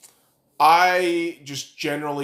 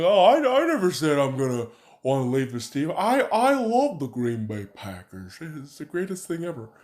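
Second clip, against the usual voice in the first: lightly put-on mock-innocent voice; mock a player's professed loyalty, drawing it out; Aaron Rodgers. Fan imitating the quarterback denying he'll leave, lightly mocking impression. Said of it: "He's like".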